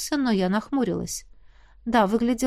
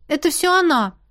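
tattling; 8 semitones higher, 4 dB louder than usual. Acted. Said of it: "с готовностью наябедничала я".

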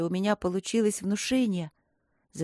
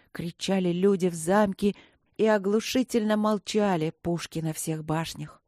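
usual speaking voice

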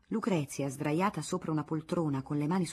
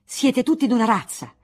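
loud, higher angry outburst; sudden shout; 5 semitones higher, 9 dB louder than usual. Acted. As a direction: blow up without warning. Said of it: "gridò improvvisamente".

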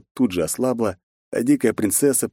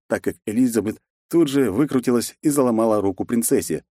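plain reading voice

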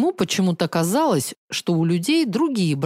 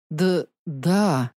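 frightened stammer; 3 semitones lower than usual. A narrator's reading. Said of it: "протянул бедняга".

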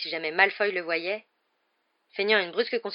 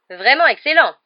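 high, loud insistent praise; commentator; 7 semitones higher, 15 dB louder than usual. A narrator's reading. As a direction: keep the commentary going loudly. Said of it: "poursuivit Jordan". (commentateur qui insiste)

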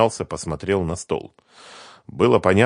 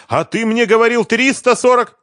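outraged shout; 10 dB louder than usual. Acted. Sister confronts lying brother.

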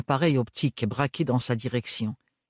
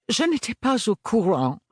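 grudging admission; 10 semitones higher than usual. A narrator's reading. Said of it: "admit Lady Septima à contre-cœur".

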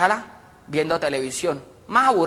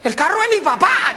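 put-on high, loud girlish call to friend; young woman's voice; 11 semitones higher, 4 dB louder than usual. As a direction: gasp it out like a gossiping girl. Girl voice.

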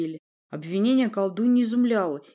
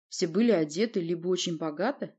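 ordinary delivery, nothing marked

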